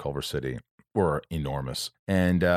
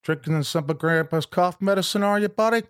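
higher, hammed-up quote of the friend's film line; Harlan's movie line; 13 semitones higher, 4 dB louder than usual. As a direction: do the actor friend's line, pitched up and louder. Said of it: "Harlan, you know, from, uh, Something About Mary".

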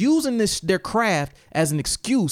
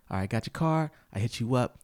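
ordinary delivery

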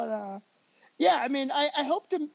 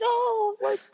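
much higher, slow sing-song pity; pitying wife; 9 semitones higher, slower than usual. Retold or imitated. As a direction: coo at him like a poor little child. His wife was there.

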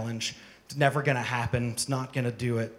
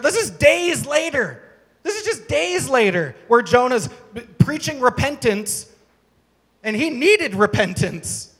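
slow, higher, louder preaching; emphatic preaching; 9 semitones higher, 8 dB louder than usual. Acted. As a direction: lean on every word, slowly. Preaching at full force.

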